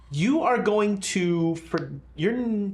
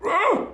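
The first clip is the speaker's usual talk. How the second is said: high snorting gasp of a sleeper; sleep apnea gasp; 14 semitones higher than usual. Acted. Game master imitates sleeping Renault, choked apnea gasp. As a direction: catch the breath like troubled sleep. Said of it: "almost sleep apnea-ing".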